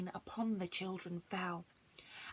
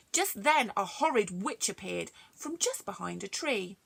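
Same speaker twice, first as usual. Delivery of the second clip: plain reading voice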